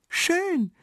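very high, admiring cry; high-pitched burst of approval; delighted exclamation. A narrator's reading. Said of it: "ruft Marli".

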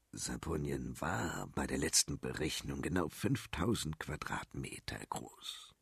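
low, hushed and sinking; sinister minister. Acted. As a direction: let it fade into a dark whisper. Minister states figure.

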